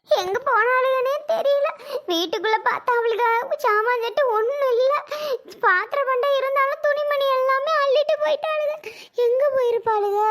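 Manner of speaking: high-pitched, wailing worry; tearful lamenting. Acted. Woman alone finds the family gone, tearful lamenting. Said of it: "அழுது புலம்பிட்டு கிடக்கா".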